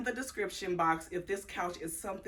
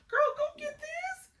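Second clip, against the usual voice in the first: excited squeal. Real, not acted. Gushing over couch, high squeal.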